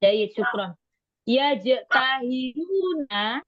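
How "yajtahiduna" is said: slow, word-by-word dictation; slow reading. Shown as a demonstration. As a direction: read it out very slowly for the class. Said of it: "pelan-pelan".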